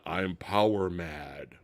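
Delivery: lightly hammed evil boast; mock villain line. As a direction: purr it like a cartoon baddie. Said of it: "have some kind of".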